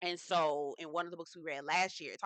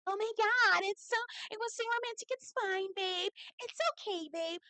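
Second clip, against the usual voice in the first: high, dainty mocking voice; whiny, high-pitched and falsely sweet; dainty whine; 12 semitones higher than usual. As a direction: imitate a sugary passive-aggressive girlfriend. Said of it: "this little dainty voice".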